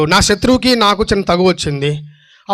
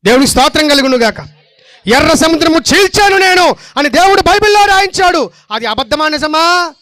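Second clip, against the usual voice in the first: high, loud, ringing declaration of truth; fervent preaching; 8 semitones higher, 5 dB louder than usual. Acted. Preacher to the congregation, fervent loud preaching. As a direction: proclaim it to the whole church.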